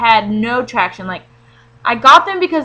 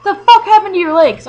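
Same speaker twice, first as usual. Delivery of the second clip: higher, louder shocked outburst; shocked boyfriend; 9 semitones higher, 6 dB louder than usual. Retold or imitated. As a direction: blurt it out at the sight of the bruise. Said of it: "he was like".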